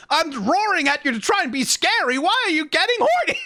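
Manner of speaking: high-pitched, bewildered mock protest; exasperated would-be scary monster; frustrated werewolf. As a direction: whine like a monster nobody fears, rising to disbelief. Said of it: "it's like being a werewolf".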